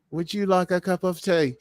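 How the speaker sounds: light English tea-party voice; mock British accent. Host echoing co-host, mock British accent.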